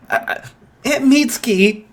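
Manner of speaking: high, embarrassed whine of a name; flustered protest. Acted. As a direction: squeak it out, caught off guard. Izuku is embarrassed, goes red.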